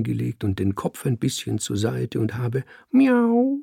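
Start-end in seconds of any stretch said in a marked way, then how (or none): 2.9-3.6 high, drawn-out miaow; cat's meow. A narrator's reading. Cat playing innocent before the man, meowing.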